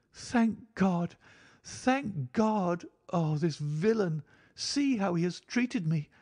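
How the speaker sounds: high-pitched relief turning to outrage; overwrought woman's voice. A narrator's reading. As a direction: cry out as a wronged wife, high. Narrator voices overwrought Mrs Stapleton.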